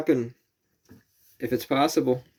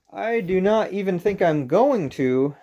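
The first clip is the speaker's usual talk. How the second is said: slower and higher, every word separate; slow careful enunciation; 7 semitones higher than usual. Shown as a demonstration. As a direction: spell out "I do not even think I'm going to" slowly and carefully. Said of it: "that's what I'd meant to say".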